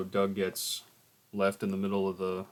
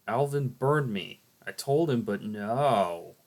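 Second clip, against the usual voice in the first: loud complaint; 3 semitones higher, 8 dB louder, slower than usual. Acted. Complaining about a burn.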